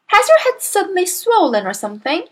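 high, innocent-sounding query; little girl voice. A narrator's reading. Daughter needling her father about his head, little girl voice. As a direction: ask high, feigning innocence.

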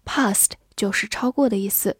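normal talking voice

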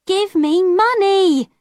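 high, slow, insistent demand; girl demanding coins from the peddler. A narrator's reading. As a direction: press him for money like a stubborn girl. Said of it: "Alison said".

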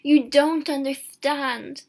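slow, loud, pained protest; said sadly. A narrator's reading. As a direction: plead slowly in distress.

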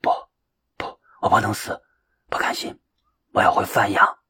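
feverish, whispered raving; delirious dying words. A narrator's reading. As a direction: rave faintly, near death. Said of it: "胡言乱语着什么".

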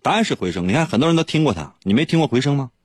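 normal talking voice

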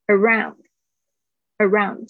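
slow "around" with full "ah-oo" glide; correct "around". Shown as a demonstration. Teacher models for students, slow correct "around". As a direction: draw out the diphthong in "around". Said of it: "千万不要把O U的这个声音忽略".

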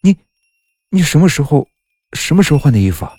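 taken aback, halting then rushed; astonished. A narrator's reading. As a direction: blurt out a baffled question, restarting as you go. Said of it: "诧异地说".

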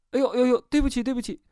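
high-pitched, hurried sorry; flustered apology. Acted. Patient apologising for the leaking backpack, flustered and high.

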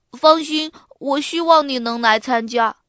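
lightly voiced boy's invitation; 邀请同学. A narrator's reading. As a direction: earnestly invite a classmate. Boy inviting classmate, first take. (用孩子的口吻邀请同学)

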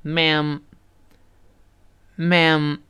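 Ma'am with lips closed at both ends; slow model Ma'am, lips shut then open then shut; ma'am with a wide ae. Shown as a demonstration. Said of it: "我们再读一下".

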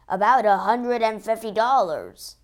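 loud answer from the crowd; calling a price across a crowd; called out. A narrator's reading. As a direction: shout it from the back. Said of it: "someone called".

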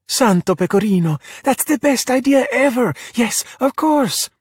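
high-pitched, thrilled exclamation; overjoyed. Acted. Giulia hears friends want to join her school, overjoyed. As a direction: burst out in delighted disbelief.

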